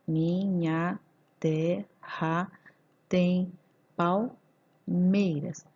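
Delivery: "minha terra tem palmeiras" very slow, pauses between syllables; syllable-by-syllable scansion. Shown as a demonstration. Teacher counting the verse's syllables, slow and separated. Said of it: "vou contar o verso até a última sílaba".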